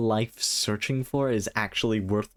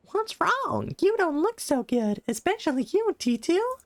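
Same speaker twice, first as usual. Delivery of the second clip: much higher, squeaky fairy companion voice; squeaky Paimon voice. Acted. Player voicing Paimon to the traveler, high squeaky concern. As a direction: pitch way up like a tiny floating fairy.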